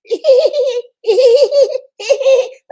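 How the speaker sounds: big burst of laughter, high and loud. Real, not acted. Laughing at the suitor she just mimicked, loud and high. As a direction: break up laughing. Laughs at her own impression.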